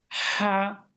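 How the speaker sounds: "হা" with breath ahead of the voice; thin, with air. Shown as a demonstration. Teacher shows learners the letter, airy thin "হা". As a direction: let air out first, then sound "হা". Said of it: "বাতাসটা আগে, শব্দটা পরে।".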